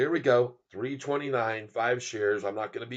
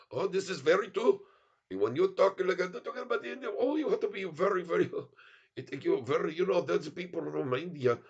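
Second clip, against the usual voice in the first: put-on higher-pitched, lilting Indian accent; 4 semitones higher than usual. Streamer lectures on respecting Indian people, put-on Indian accent. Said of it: "India accent is, is tough to do".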